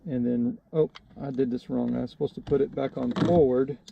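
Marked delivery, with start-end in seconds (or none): none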